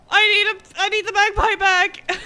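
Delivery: high frantic panic; shrieking in real panic; panicked. Real, not acted. Item despawned, panicking.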